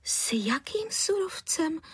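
slow, high-pitched bewildered question; baffled, lightened voice; puzzled surprise. A narrator's reading. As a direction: ask in astonishment, drawing it out. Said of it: "podivila se paní Středníková".

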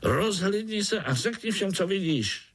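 high-pitched eager urging; little spider hero. A narrator's reading. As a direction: pipe up high, coaxing a listener. Pája urges the spider to look. (vysoký hlásek pobízejícího pavoučka)

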